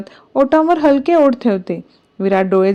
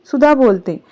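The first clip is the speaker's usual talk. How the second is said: ordinary delivery, nothing marked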